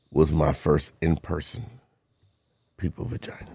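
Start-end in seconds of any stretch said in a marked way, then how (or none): 2.8-3.5 hushed whisper. Real, not acted. Confiding to his friend, hushed whisper. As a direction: whisper it like a secret.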